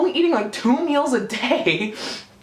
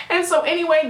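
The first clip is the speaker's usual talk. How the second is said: ordinary talk, nothing marked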